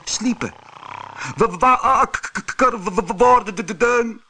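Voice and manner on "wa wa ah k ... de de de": high, stammering wake-up call; loud, high-pitched stutter. A narrator's reading. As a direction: call out, tripping over every word. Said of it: "riep Harro".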